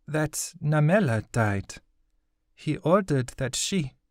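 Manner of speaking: put-on high girl's voice, slow and faltering; a girl forcing out a painful memory; mournful girl's voice. Girl tells Hattie how his wife died, high and slow. Okizi recalls the death, slow.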